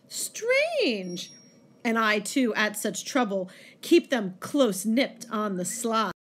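scheming monk's monologue, swinging in pitch and pace; dramatic poem reading. Acted. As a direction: act out the poem with much feeling.

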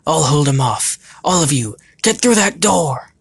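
high, loud urgent order; yelling. A narrator's reading. Phantom sends the crowd through the door, yelling. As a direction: shout it high over a crowd. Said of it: "levitated above the crowd and yelled".

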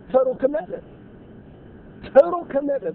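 preacher's building emphasis; building emphatic call. Real, not acted.

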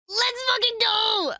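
high, drawn-out triumphant yell; excited cheer. Real, not acted. Fan celebrating that her theory came true.

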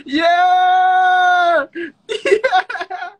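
very high, loud squealed cheer; delighted cheer. Real, not acted. Streamer cheering a fan's heart sign, delighted squeal. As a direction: cheer at the top of your voice, laughing. Fan makes a heart for her.

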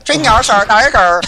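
loud, high, hammed-up fake Chinese accent; mock Chinese accent. Gamer imitating Chinese speakers for laughs, loud mock Chinese accent. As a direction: shout it out in a caricature Chinese accent. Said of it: "That's how they talk".

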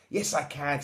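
lightly triumphant cub; mild triumph. A narrator's reading. Little Lion to his parents, mildly triumphant. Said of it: "said Little Lion triumphantly".

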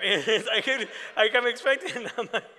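cracking up while telling it; laughing out loud at the memory. Real, not acted. Laughs recalling the awkward moment.

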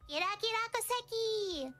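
high, sing-song imitation of a friend's intro; Kira's intro voice. Imitating her intro for the chat. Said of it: "what's her intro?".

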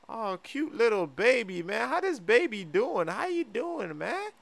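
put-on cooing at baby. Friend greets Drake's baby, high cooing. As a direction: coo sweetly over an infant.

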